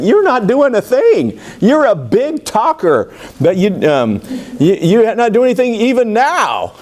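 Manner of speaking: accusing Moses. Retold or imitated. Moses throwing the charge back at God, high and accusing.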